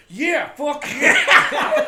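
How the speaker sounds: very high, loud, drawn-out whoop; cheering yell. Retold or imitated. Guy across the counter whooping in support, high and very loud. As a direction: cheer it like you're all in.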